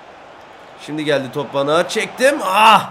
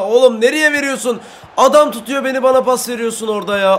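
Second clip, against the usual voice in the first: scolding teammate; 6 semitones higher, 4 dB louder than usual. Real, not acted. Scolding teammate for bad pass.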